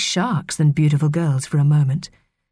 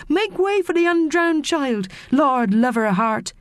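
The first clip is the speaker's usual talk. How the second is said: mermaid calling out; 9 semitones higher than usual. A narrator's reading. Mermaid announces the undrowned child's arrival, calling out high. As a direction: sing out high to clear the way.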